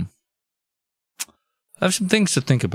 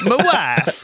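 high, mock Kazakh comic accent; hammed-up fake Eastern European accent; Borat; 6 semitones higher than usual. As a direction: put on a silly foreign accent, higher pitched. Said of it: "You turned into Borat".

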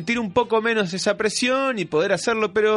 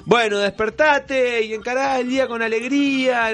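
upbeat life coach; 6 semitones higher than usual. Retold or imitated. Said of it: "que hace recomendaciones vitales".

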